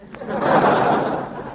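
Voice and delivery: short breathy chuckle; chuckle at own joke. Acted. Archie chuckling at his own Duffy joke, breathy laugh. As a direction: laugh at your own gag.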